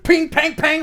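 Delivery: high, loud ringing pings; imitated coin impacts. Game master describing coins pelting a breastplate, high impact sounds. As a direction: punch out ricochet noises. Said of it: "as these coins start".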